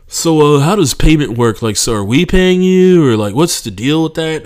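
mock general manager asking who pays; clueless manager. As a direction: sound oblivious and casual. Manager asks about payment.

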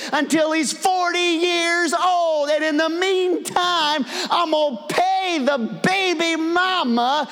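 high, loud, shouting declaration; booming voice of God. Retold or imitated. Preacher voicing God to the congregation, booming. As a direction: proclaim it like God Himself thundering. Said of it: "God's saying".

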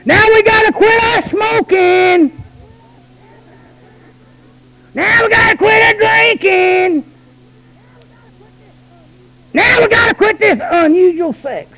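high, loud, slow preacher's chant; preaching shout. Acted. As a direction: proclaim each line like a call. Preaching at full force.

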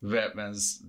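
ordinary talk

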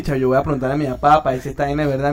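fast, low-key rush through the line; hurried goodbye. Retold or imitated. Hanging up with Millán to go ask his dad, rushed. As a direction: get off the phone quickly.

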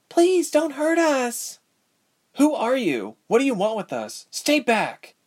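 high, panicked plea; terrified character voice; frightened calling out. A narrator's reading. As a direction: cry out in fear, pitch way up. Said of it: "another voice called out".

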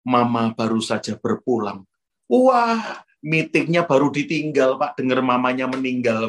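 normal talking voice

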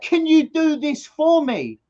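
put-on whiny little-boy request; pleading child. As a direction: beg like a five-year-old who has given up. Child begging nan for help.

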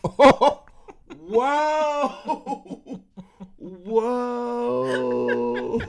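loud high laughing exclamation; real laughing whoa. Real, not acted. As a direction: cry out through laughter. Wife roasting him.

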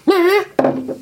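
very high cat nah. Retold or imitated. Imagining the cat's meow as a word, very high. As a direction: squeak it like a cat. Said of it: "when it came in and goes".

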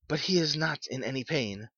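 plain reading voice

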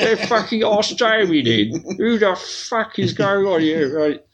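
high-pitched pretend tirade; playful, high-voiced berating; mock outrage. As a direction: rant in sham exasperation. Mock tirade at co-host and producer.

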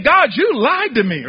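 loud, high-pitched accusation; accusing God. Acted. Preacher paraphrases the prophet's charge against God, accusing. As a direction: preach it as an indignant complaint. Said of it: "That's like saying".

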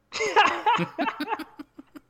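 high-pitched giggling laugh; long, high laughing fit; burst of laughter. Real, not acted. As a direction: laugh hard at your own joke. Laughing at own knock-knock joke.

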